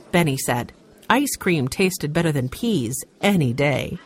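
ordinary delivery, nothing marked

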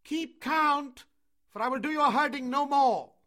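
high, loud, contemptuous tirade; scornful shout. A narrator's reading. Mowgli leaving the children of men, scornful shout. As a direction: shout your scorn at the whole village. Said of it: "shouted Mowgli scornfully".

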